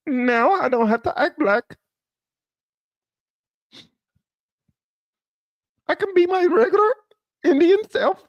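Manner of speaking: high put-on voice of Kamala; mock her in a high silly voice. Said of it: "It's like".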